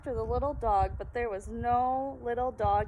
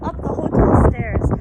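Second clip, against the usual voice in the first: plain reading voice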